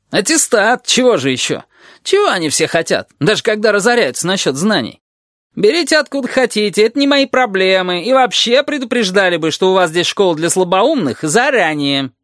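high-pitched sarcastic griping; aggrieved lizard. A narrator's reading. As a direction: complain hotly, capped with a bitter tag question. (ящер, возмущённо жалуется)

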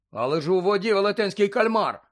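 bright-hearted hope, voice lifted; hopeful. A narrator's reading. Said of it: "Його голос не звучав стурбовано, навпаки".

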